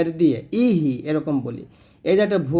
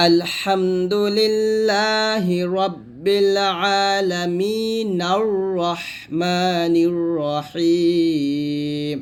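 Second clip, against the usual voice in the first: slow chant linking both verses; long held vowels, no stop between verses; joined recitation; 3 semitones higher, much slower than usual. Shown as a demonstration. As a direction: recite the two verses as one flowing line. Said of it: "মিলায় পড়েন তাহলে এরকম পড়বেন".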